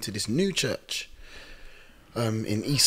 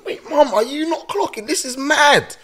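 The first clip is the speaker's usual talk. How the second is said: high-pitched, incredulous appeal to mum; acted astonishment, voice shooting up; amazed disbelief; 4 dB louder than usual. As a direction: squeal it in mock alarm. Stunned thought to mum, retold.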